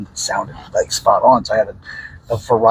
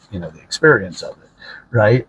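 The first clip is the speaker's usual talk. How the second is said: normal talking voice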